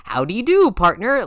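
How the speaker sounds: a hammed-up western howdy; cowboy greeting. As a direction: greet him like a hammy cowboy. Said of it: "does he just walk up to Laconis like".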